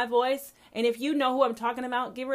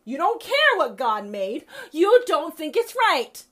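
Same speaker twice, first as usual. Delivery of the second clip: louder, higher, slowed and pointed; slow angry shout; 7 semitones higher, 8 dB louder than usual. Real, not acted. Accusing judgmental Christians, loud and slow. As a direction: spit each word out slowly. Rant against judges.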